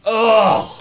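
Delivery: exasperated ugh. Retold or imitated. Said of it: "You're like".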